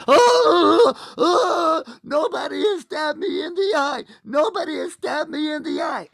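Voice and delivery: high, hammed-up howl of pain; wounded Cyclops wailing. Cyclops stabbed in the eye, hammed-up high wail. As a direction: wail like a comic giant in agony. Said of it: "he taps him in the eye".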